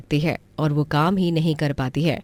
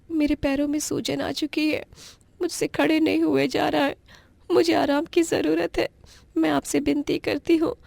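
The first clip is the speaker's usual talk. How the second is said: put-on much higher, weak begging girl voice; pleading young girl; 12 semitones higher than usual. Orphan niece begging her aunt and uncle for rest, high and pleading. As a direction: beg for rest, exhausted and aching.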